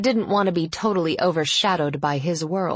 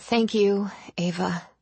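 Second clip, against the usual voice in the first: whispered, on the edge of tears; barely holding it together, under her breath; shaky whisper; 5 semitones higher than usual. Acted. Friend thanking Ava on the phone, shaky whisper. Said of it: "She took a deep, shaky breath, her composure slipping".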